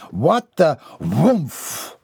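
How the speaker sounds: slow, knocked-breath exclamation; winded grunt. Acted. The man is rammed in the belly, gasping. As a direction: get the wind knocked out mid-sentence. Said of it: "drove her head into".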